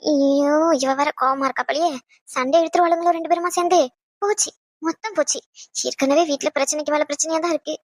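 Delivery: low, soft fretting to himself; worried aside. Acted. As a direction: fret under the breath as trouble builds. Worried aside as trouble brews.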